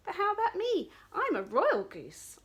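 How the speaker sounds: the royal goose volunteers; eager goose. Acted. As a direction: honk it proudly. Goose offers herself.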